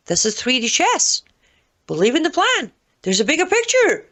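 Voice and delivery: high-pitched sarcastic parroting of loyalists; mock true believer. Mocking excuses of Trump supporters.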